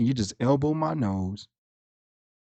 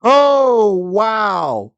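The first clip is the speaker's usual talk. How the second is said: much higher, louder gasp of wow; stunned oh wow; 9 semitones higher, 10 dB louder than usual. Real, not acted. Friend hearing how petty Dio is, loud stunned wow. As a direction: caught off guard, loud.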